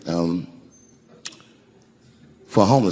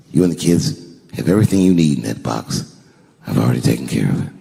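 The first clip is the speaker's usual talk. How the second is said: low, solemn comforting voice; tender reassurance; 8 semitones lower than usual. Retold or imitated. Husband handing his crying wife the keys, tender and grave. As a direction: reassure her gently, pitched well down.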